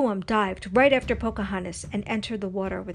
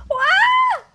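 very high, piercing "waah" scream; loud scream; 11 dB louder than usual. A narrator's reading. Nakoma thrown from her canoe, screaming. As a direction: scream out as you fall. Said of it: "heard a loud scream".

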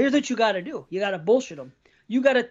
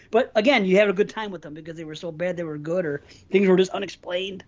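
normal talking voice

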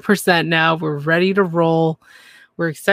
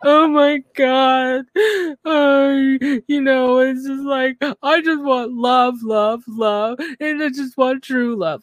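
high, slow, hammed-up whining; mock weepy Darcy; 7 semitones higher, slower than usual. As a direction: whimper it like a melodramatic crier. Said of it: "she's just really like".

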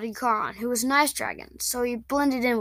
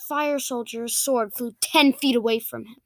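ordinary delivery, nothing marked

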